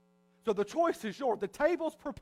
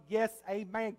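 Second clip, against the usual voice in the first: ordinary talk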